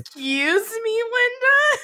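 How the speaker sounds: mock indignant. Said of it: "so you're like".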